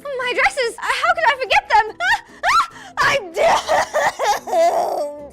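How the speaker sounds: high, loud hammed-up wailing pony; mock sobbing shout of a diva who forgot everything; melodramatic panic. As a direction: overplay the fainting-couch despair. Voicing Rarity's panic over dresses.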